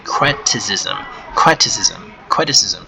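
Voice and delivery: criticism said slowly three times, each syllable clear; repeating criticism. Shown as a demonstration. Teacher drills criticism for learners, careful pronunciation. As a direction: model criticism for the class to repeat. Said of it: "let's say the word again".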